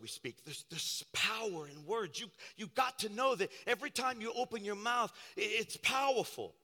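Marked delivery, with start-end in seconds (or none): none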